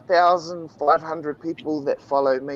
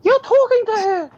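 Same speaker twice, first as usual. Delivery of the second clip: put-on high girlfriend voice; 5 dB louder than usual. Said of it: "my girlfriend notices and says".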